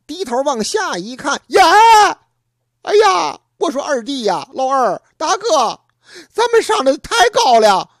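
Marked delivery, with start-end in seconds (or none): 1.5-7.9 alarmed cry. Acted. Brothers panic on the cliff.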